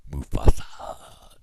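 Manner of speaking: slow breathy whisper of the name; low dramatic whisper; spooky whisper. Pretend. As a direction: hush it like a secret.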